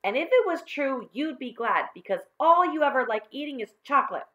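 high, loud disbelief; scornful schoolgirl retort; scornful girl. Acted.

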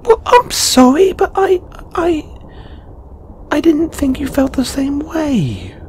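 very high-pitched, exasperated rush of protest; flustered outburst. Acted. Learning the feeling is mutual, flustered exasperated outburst. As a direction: pour it out in a squeaky high pitch.

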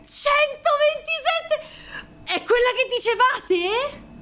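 high, slow, astonished cries; high thrilled exclamations; amazed. Real, not acted. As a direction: wail the number in disbelief. Amazed at the card.

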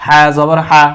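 final হা kept short; হরকতের উচ্চারণ. Shown as a demonstration. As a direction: spell out হা জবর হা, no stretch at the end. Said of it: "হরকতের উচ্চারণের মধ্যে টান হয় না". (শেষের হা টান ছাড়া)

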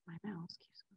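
barely audible whispered aside; muttered aside. Real, not acted. Presenter to self about a misbehaving mouse, whispered aside. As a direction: mutter it under your breath, almost no voice.

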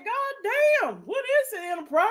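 squeaky high mock outburst; high mock exclaim. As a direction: pitch jumps way up in disbelief. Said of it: "I said".

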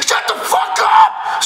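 screeched, very high mock yell; slow, high-pitched mock scream. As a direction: shriek it at him in fake outrage. Mock yelling at the fan.

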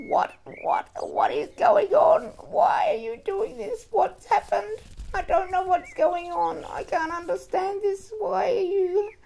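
very high, loud, bewildered cry; distraught pleading. Acted. Child to mother who has gone crazy, distraught high pleading. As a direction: plead for answers in a high, strained voice. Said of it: "and I'm going".

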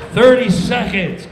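loud, slow countdown call. Retold or imitated. Stage manager counting down to air: loud call. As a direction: call out the time loudly.

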